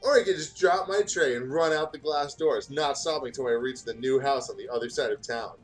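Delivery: ordinary talk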